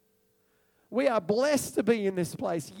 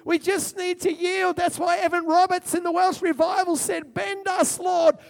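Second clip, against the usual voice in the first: full-force preaching; 7 semitones higher, 6 dB louder than usual. Acted. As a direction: preach it like a revival climax.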